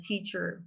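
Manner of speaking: teacher with an er ending; teacher the American way, with its R; American teacher, final R sounded. Shown as a demonstration. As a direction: pronounce the R closing teacher. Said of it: "And in American".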